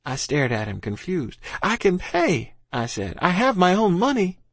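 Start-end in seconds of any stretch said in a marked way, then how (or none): 1.6-2.5 put-on boy's voice. A narrator's reading. Boy pleading. 3.2-4.4 put-on boy's voice. A narrator's reading. Boy pleading.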